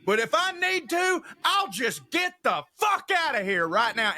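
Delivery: high huffy heckler voice; sulky drunk exit line; heckler threatening to leave. Retold or imitated. Heckler threatening to leave the show, high and huffy. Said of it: "he's like".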